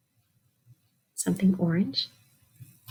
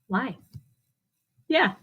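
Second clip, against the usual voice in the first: plain reading voice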